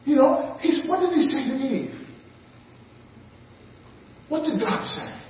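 usual speaking voice

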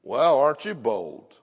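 slow, mock-admiring jab; sarcastic. Preacher mocking the congregation, slow sarcastic praise. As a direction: needle them with fake praise. Mocking people who expose family to drunks.

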